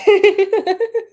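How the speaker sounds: high, loud burst of laughter. Real, not acted. Streamer hears a viewer's story, loud high laugh. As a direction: crack up out loud.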